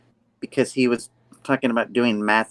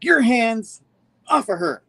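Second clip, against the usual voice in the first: high, raised mock-heroic order; acted angry command; 11 semitones higher, 4 dB louder than usual. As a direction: call out a hero's warning. Acts out Peter defending MJ.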